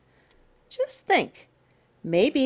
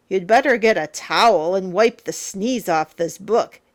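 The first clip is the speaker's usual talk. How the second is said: plain reading voice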